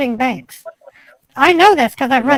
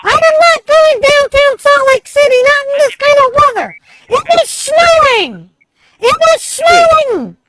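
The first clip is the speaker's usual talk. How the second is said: loud angry rant; 10 semitones higher, 4 dB louder than usual. Acted. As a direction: rant at him, pitch way up. Acted rant at scam caller.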